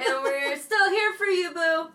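calling from the hallway; sing-song call from down the hall; from another room. Real, not acted. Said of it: "I'm in the hallway".

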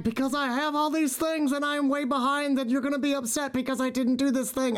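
high, lightly upset employee voice; mock tearful retelling. Overwhelmed employee explains the tears to the boss, mildly tearful. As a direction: imitate an overwhelmed employee, pitched high without sobbing. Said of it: "And they would cry".

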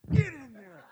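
high-pitched urgent command; acted wifely order. Wife shoving husband into the restroom, high-pitched bossy order.

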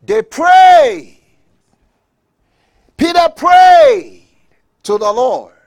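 high, very loud, drawn-out cry; preacher's shout. Real, not acted. Preacher telling of Peter's prayer, shouting at full force. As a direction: shout the climax to the congregation.

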